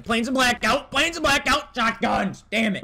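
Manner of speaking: loud, high burst of alarm; real gameplay outburst. Real, not acted. Gamer caught by shotguns mid-plug, shouting. Sudden outburst amid gunfight.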